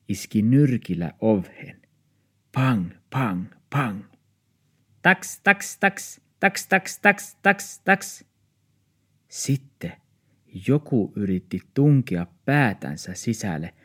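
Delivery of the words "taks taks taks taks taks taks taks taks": high yappy barks; little dog barking. Acted. Small dog barks at two dogs outside, high yaps. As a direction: yap like a tiny dog.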